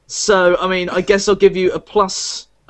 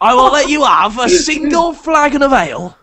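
higher, hearty tavern barkeep; greasy Hagrid barkeep; 5 semitones higher than usual. Acted. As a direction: haggle like a grubby innkeeper. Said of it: "is this like a greasy Hagrid?".

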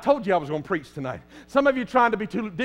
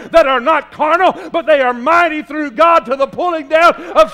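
louder, higher preacher's cry rising through the list; fiery preaching; 7 semitones higher, 7 dB louder than usual. Acted. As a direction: preach it hard, building as you go. Preaching builds to full force.

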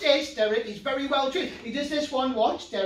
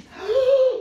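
high shocked gasp; 12 semitones higher, 7 dB louder than usual. Acted. Kiss of life goes wrong.

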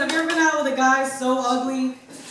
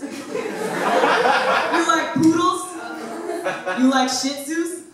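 slow, luring, higher sing-song; lightly voiced creep in a van; creepy van guy; 3 semitones higher, slower than usual. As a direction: bait her like a sleazy stranger. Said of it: "he goes".

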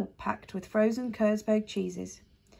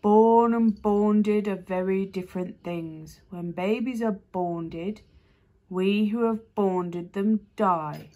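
slow, lower beast voice; slow deep creature voice; 4 dB louder, much slower than usual. A narrator's reading. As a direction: speak like a great gentle monster. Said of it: "in its deep voice".

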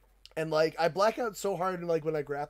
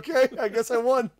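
bewildered acceptance; 6 semitones higher, 7 dB louder than usual. Retold or imitated. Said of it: "I was like".

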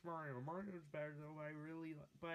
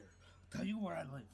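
usual speaking voice

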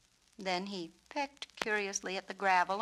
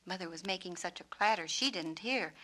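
ordinary delivery, nothing marked

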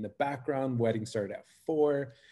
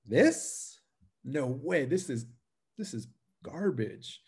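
incredulous high question, halting after it; retold disbelief; 3 semitones higher, slower than usual. Painter doubting own work as couple cry, disbelieving. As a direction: replay baffled self-doubt, higher pitched.

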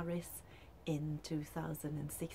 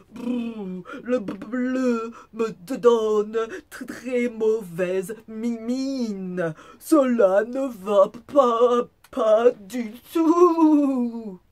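loud, high shivering wolf, stammering with cold; hammed-up freezing shiver; teeth chattering; 6 semitones higher, 18 dB louder, much slower than usual. A narrator's reading. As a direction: stutter the consonants as if frozen, drag out the last word. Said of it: "En claquant des dents".

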